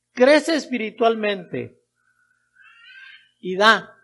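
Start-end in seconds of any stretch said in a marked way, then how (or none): none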